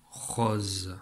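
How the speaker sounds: "rose" said slowly, held to its final z; final sound drawn out. Shown as a demonstration. Teacher showing parents the word left without O, sounded out. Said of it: "ça donne quoi".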